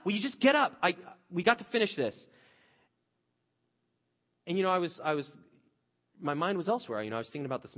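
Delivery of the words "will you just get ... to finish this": higher and louder, exasperated shouting; retold yell. Father rushing daughter out of bath, shouting. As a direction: hurry the kid up, fed up.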